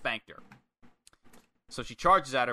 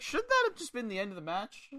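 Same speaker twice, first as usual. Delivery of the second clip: high-pitched puzzled question; imitated commentator's query; 13 semitones higher than usual. Commentator questioning why the match continues, imitated high query. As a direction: ask it high, playing a baffled announcer. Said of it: "the guy's like".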